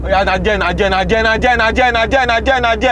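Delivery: insistent rapid-fire repetition, pitch climbing; urgent directing. Acted. Urgent directions shouted at the driver.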